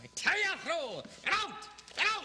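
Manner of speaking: angry dismissal. Acted. Agent throws actor out.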